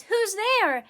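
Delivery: high, loud call; startled cry. A narrator's reading. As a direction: cry out like an alarmed mouse. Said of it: "Mouse cries".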